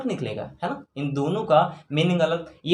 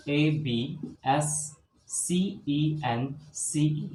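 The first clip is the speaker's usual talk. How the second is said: very slow spelling; 5 dB quieter, much slower than usual. Shown as a demonstration. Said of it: "teacher spells out letters".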